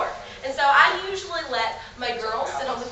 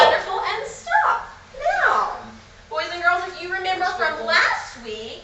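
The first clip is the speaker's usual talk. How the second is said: high, bright sing-song teacher voice; excited teacher to little kids; kids show host; 5 semitones higher, 4 dB louder than usual. Shown as a demonstration. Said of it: "talking to you once again like the normal age".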